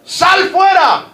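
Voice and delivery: high, loud, drawn-out call, very slow on sal fuera; Jesus shouting Lazarus out of the grave; commanding shout. Retold or imitated. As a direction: call the dead man out of the tomb. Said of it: "pega su grito".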